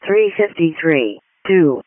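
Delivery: loud, measured call-out over the radio; dispatch announcement. Acted. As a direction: announce the call slowly and loudly.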